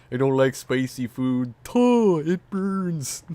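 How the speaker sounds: high goofy mock voice; Stu impression. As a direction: overdo his whiny complaint. Imitating Stu meme line.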